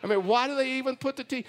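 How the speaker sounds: quick, mock-indignant protest; retold protest. Husband retelling his argument with his wife, indignant protest. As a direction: argue back in a rush. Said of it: "I'm like".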